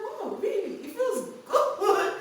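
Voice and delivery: very high, slow, giggling wonder; amazed relief. Retold or imitated. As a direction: marvel in delighted relief. Relief after sister explains period.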